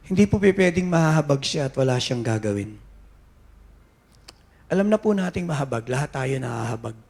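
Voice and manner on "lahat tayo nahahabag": normal talking voice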